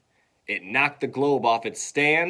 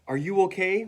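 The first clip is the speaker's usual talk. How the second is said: high, rising question; concerned asking; 8 semitones higher than usual. Acted. Class gathers round fallen teacher, high concerned question. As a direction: lift it like worried children.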